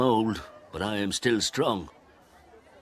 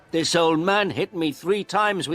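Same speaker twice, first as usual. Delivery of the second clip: shouting; 12 semitones higher, 4 dB louder than usual. A narrator's reading. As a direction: denounce him to the policeman. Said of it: "The photographer shouted to the policeman".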